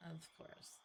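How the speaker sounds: dry whispered of course; whispered aside. Real, not acted. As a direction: breathe it out sarcastically. Player's sarcastic aside.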